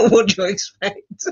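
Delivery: laughing it off, pitch up. Real, not acted. To viewers, jokingly, through laughter. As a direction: say it through a real chuckle. Jokes about being common as muck.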